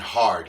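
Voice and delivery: "hard" punched loud as the end of "heard"; Sasquatch pronunciation. Shown as a demonstration. Teaching viewers a Sasquatch greeting, "hard" said loud and firm. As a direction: say "hard" with a strong loud push. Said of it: "D, like the letter D, and hard".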